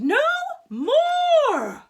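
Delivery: very high, loud outraged cry; scream. Acted. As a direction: shriek it out. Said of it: "the queen screamed".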